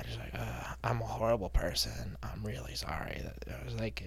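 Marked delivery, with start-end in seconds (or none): 0.4-3.2 softer, slower mumble; quiet hangdog sorry; mock ashamed apology. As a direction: sheepishly apologize.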